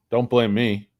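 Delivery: ordinary delivery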